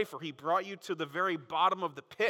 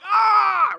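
very high, very loud yelp of pain; loud hammed-up cry of pain; pain scream; 16 dB louder than usual. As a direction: howl like you just stubbed your toe. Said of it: "your body screams with pain".

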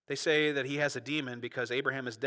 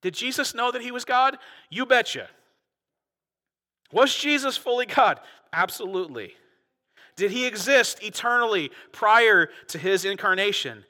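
loud, rising pulpit fervor, louder; preaching at full force; 7 semitones higher, 8 dB louder than usual. Acted. Preacher rousing his congregation, full-throated and loud. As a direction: build the outrage to the back row.

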